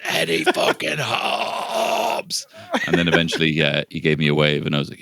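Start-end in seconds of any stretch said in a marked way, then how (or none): 0.0-2.5 high, slow stretched fan yell; drawn-out yell. Retold or imitated. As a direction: drag the name out at the balcony. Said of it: "I said".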